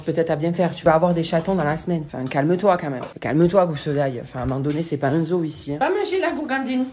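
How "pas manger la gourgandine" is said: higher playful scolding of the dog; higher mock-scold to the dog; pet talk. Real, not acted. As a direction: tease the dog in a high voice.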